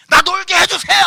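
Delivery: 높고 크게 조르는 흉내; 놀게 해달라고 떼쓰듯 외치기; 떼쓰는 아이 흉내. Retold or imitated. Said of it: "막 이러고 있어". (크게 떼쓰는 흉내)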